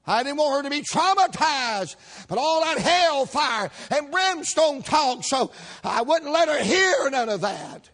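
mock outraged hairdresser. Hairdresser scandalized telling the shop about the preacher, hammed up. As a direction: play up her horror at the hellfire.

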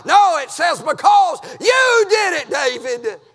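loud, high preaching holler; full-force revival preaching. Acted. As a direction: hammer it home from the pulpit.

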